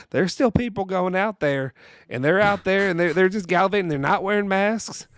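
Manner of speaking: a mock Southern official scolding people on the radio; Southern accent. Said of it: "like, Southern accent".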